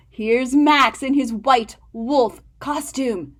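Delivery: usual speaking voice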